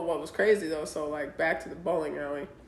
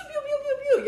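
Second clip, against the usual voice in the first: high squeaky pew pews; hiccup imitation; much faster than usual. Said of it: "I had the hiccups like".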